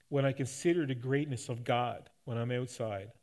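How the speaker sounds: usual speaking voice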